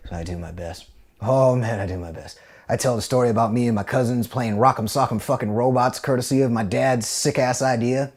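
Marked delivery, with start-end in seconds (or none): none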